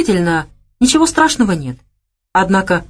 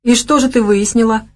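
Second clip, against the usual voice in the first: angry scoff; 6 semitones higher than usual. A narrator's reading. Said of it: "зло хмыкнул Лёша".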